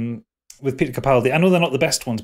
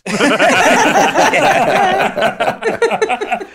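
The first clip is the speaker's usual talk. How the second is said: loud laugh; 6 dB louder than usual. Real, not acted. Laughing at his own quote.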